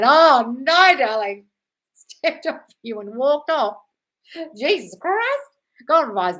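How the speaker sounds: high, loud, horrified dismay; appalled sympathy. Real, not acted. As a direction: sound shocked, almost wailing. Reacting to a viewer's sad story.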